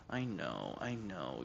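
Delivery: soft, low murmur to the cat; soothing the cat. Real, not acted. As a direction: gently hush a pet.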